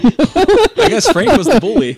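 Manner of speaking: loud, high, slowed by laughter; real laughter bursting through the words; laughing hard. Real, not acted. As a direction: crack up while talking. Cracks up at an interruption.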